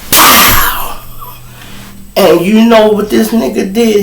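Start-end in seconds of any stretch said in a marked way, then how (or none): 0.1-1.0 unvoiced pow effect; whispered pow effect; breathy slap noise. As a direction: spit out the slap sound.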